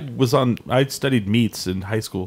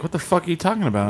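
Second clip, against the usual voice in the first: higher-pitched mock indignation; retold affront, pitched up in disbelief; offended; 7 semitones higher than usual. Man retells being asked about fake Thanksgiving, mock offended. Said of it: "I kinda got offended".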